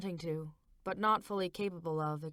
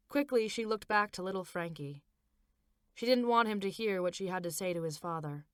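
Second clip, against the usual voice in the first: ordinary delivery, nothing marked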